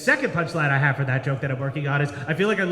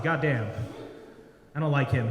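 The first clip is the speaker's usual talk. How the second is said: ordinary talk, nothing marked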